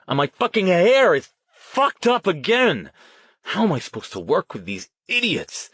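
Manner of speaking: high, loud whining outburst; angry tantrum. A narrator's reading. Riley fuming about hair and colleagues, angry tantrum. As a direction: rant in exasperation at the team.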